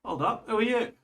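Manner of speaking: a hint of a wary gate guard calling out; young female guard. Gate guard stops the strangers, lightly voiced young woman. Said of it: "you hear a young female voice go".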